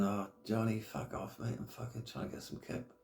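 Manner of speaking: hushed, half-asleep grumble at her husband; sleepy wife brush-off. Retold or imitated. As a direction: mutter it like you're trying to sleep. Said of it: "probably thinking".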